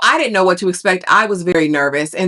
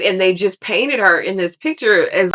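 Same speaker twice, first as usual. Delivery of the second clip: ordinary delivery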